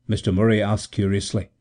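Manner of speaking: plain reading voice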